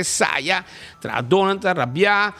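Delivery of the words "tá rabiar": ordinary talk, nothing marked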